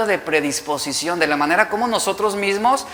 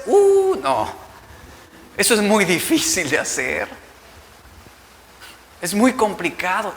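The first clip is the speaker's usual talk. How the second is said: put-on rich young man balking. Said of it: "¿Recuerdas al joven rico?".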